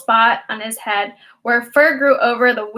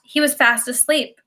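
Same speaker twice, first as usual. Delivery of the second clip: ordinary delivery, nothing marked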